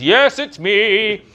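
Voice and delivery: mock-grand Tom Baker call; grand announcement. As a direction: proclaim it grandly over the shoulder. Tom calls out to passers-by.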